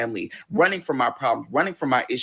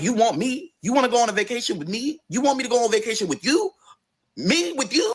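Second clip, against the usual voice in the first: put-on high, fast, amazed questions from a stunned boyfriend; he can't believe she wants him along; amazed disbelief; 7 semitones higher than usual. Boyfriend quoted, amazed she asks.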